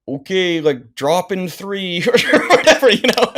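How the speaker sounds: high, giggling mock-casual quote; laughing through it. Telling the story of an angry guard, laughing through the quote. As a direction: laugh your way through the line. Laughing while retelling own calm reply.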